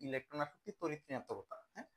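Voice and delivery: ordinary talk, nothing marked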